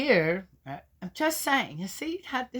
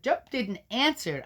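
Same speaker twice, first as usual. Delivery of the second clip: usual speaking voice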